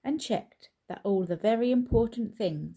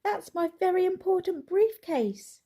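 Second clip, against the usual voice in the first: much higher, louder pleading call; high loud plea; 11 semitones higher, 5 dB louder than usual. A narrator's reading. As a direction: shout after the cart, desperate. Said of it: "called Mister Brown".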